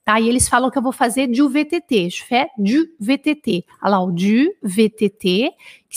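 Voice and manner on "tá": ordinary delivery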